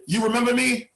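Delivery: hopeful kid asking, lightly voiced; a hint of a kid's voice; eager kid. Kid asks if remembered.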